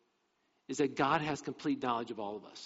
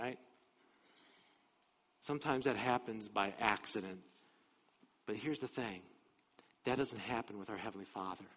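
usual speaking voice

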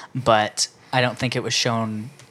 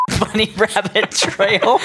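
laughing; 10 semitones higher, 3 dB louder, much slower than usual. Real, not acted. Laughing after a silly tangent.

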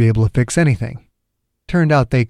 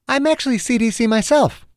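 much higher-pitched voice for the heroine; woman character's voice; 11 semitones higher than usual. Acted. Margaret introduces herself to Doctor Chang, high woman's voice. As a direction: pitch it high, as a female caller. Acted heroine answers on phone.

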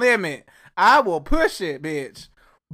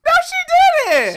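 high indignant denial; 7 dB louder, slower than usual. Real, not acted. Arguing over what happened.

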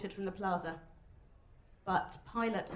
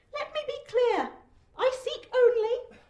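high-pitched pompous public address; weedy, thin shout; 7 dB louder than usual. A narrator's reading. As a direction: declaim it thinly to a hostile crowd. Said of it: "his delivery is weedy and thin".

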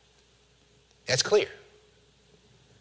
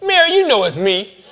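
high, loud, casual chiding; playful voice of someone well known; familiar, recognizable tone; 9 semitones higher, 6 dB louder than usual. Acted. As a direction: call out to a friend who should know you. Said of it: "said it in a tone ... sounded like Jesus".

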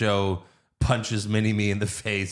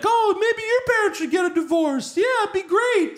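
put-on much higher, nasal bratty kid. Podcaster voices Jamie's asshole friend taunting him, high kid voice. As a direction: taunt like a smug schoolyard brat. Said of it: "he's like".

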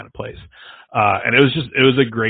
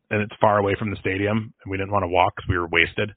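ordinary talk, nothing marked

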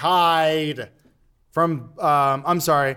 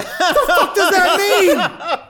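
baffled disbelief; 14 semitones higher, 6 dB louder, much slower than usual. Retold or imitated. Co-workers baffled by font note.

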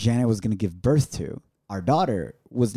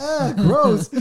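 high, acted-out revulsion; mock grossed-out groan; disgusted; 12 semitones higher, 4 dB louder than usual. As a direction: recoil from something wet. Acts disgust at the wet mattress.

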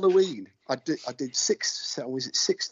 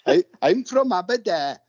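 higher, lilting Valleys Welsh voice; Valleys Welsh accent; 5 semitones higher than usual. Acted. Joking about where he's from, in a put-on Valleys Welsh accent. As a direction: exaggerate a Valleys Welsh lilt. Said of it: "best Valley's accent".

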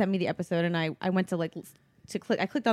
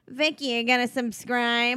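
mom's Brooklyn voice; mother impression. Daughter imitating her mother urging Vicky to subscribe, mock motherly voice. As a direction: imitate mom pushing her podcast. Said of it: "it was my mother who was like".

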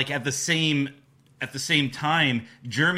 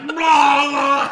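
mock yell; 12 semitones higher, 8 dB louder than usual. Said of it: "he's just like".

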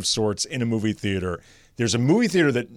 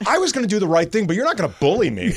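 fast, high, heated retort; indignant; 8 semitones higher, much faster than usual. Retold or imitated. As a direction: fire it back fast in indignation. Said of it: "I'm like".

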